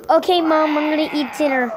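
put-on playful kid shouting an answer to mom. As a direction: call back to mom like a child.

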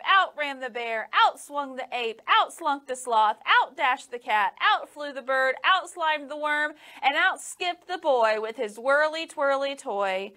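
plain reading voice

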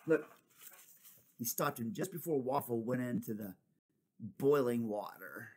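ordinary delivery

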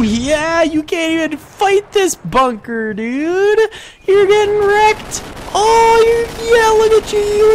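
very high, loud, whooping taunts; manic gloating. Real, not acted. Gunner to men storming his bunker, manic gloating. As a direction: go wild, voice soaring high. Gloating while gunning down attackers.

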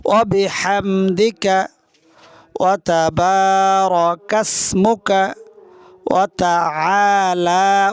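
ওয়া তাবারাকাসমুকা drawn out slowly, each syllable clear; slow Arabic recitation demo. Shown as a demonstration. Teacher reciting the Sana for students, slow and clear. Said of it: "আবার আমার সাথে".

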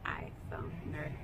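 whispered tease. Real, not acted. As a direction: whisper the words iPhone nerd. Whispered repeat.

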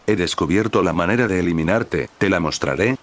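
ordinary delivery, nothing marked

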